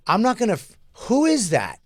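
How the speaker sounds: high, loud huffy diva rant; mocking outraged star. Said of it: "she actually said".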